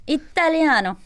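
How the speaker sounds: hammed-up Italian accent on one word. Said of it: "you do the accent again".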